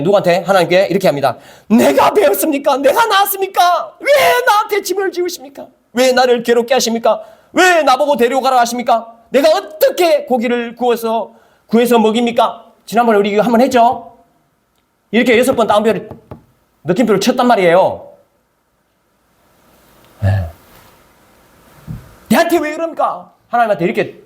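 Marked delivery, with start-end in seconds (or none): 1.7-12.6 high, pleading outburst; ranting at God. Retold or imitated. Moses protesting to God, high and straining. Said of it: "하나님께 난리를 치는 거예요". 22.3-23.3 talking back. Retold or imitated. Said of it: "대들고 따지는 거죠".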